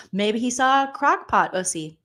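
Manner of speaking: ordinary talk, nothing marked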